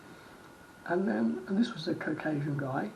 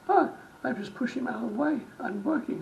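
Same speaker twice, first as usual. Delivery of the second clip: defensive; 7 semitones higher, 5 dB louder than usual. Retold or imitated. Worker's defensive reply acted out.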